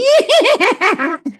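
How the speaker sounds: loud, high-pitched mocking horse whinny; laughing neigh. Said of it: "goes with a laughing neigh".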